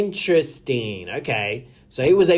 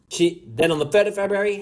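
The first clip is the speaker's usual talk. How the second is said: ordinary talk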